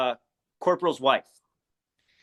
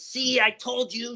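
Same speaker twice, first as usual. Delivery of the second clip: celebrating; 8 semitones higher, slower than usual. Retold or imitated. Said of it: "throws her arms up, celebrates".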